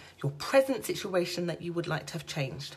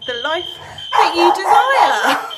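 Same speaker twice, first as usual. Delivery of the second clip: soaring finish; 10 semitones higher, 14 dB louder, slower than usual. Real, not acted. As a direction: voice climbs high and loud, slowing on desire. Dramatic build on manifesting.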